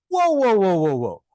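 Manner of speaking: loud, high startled whoas that drop away. Retold or imitated. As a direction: pull up short in disbelief. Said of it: "And I said".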